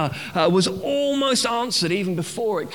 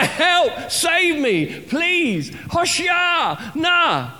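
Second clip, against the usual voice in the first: hammed-up drowning cry, much higher and louder; mock cry for help; 11 semitones higher, 3 dB louder, much slower than usual. Preacher acting a man fallen into Shadwell Basin, mock panicked. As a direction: call out like someone fallen in the basin. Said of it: "you cry".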